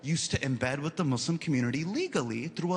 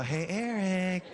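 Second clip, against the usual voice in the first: slow teasing call; slower than usual. Retold or imitated. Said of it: "I go".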